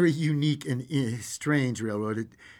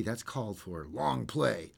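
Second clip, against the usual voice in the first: usual speaking voice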